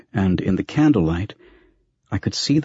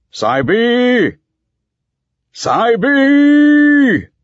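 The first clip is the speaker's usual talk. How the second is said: much higher, much louder long call through cupped hands; 7 dB louder than usual. A narrator's reading. Blind master calls his apprentice down the corridors, loud ringing call. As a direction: hail a servant far down dark corridors. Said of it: "raise his hands to form a trumpet".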